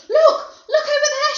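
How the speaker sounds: very high, very loud shriek; piercing excited yell; screamed. A narrator's reading. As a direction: scream it across the river in excitement. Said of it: "she screamed".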